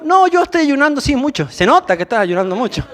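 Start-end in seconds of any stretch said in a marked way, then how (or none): 0.0-1.5 higher, self-mocking claim of fasting; preacher mocking his own excuse, a higher mock-innocent claim. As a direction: fib playfully about fasting. Preacher mocks own fake fasting.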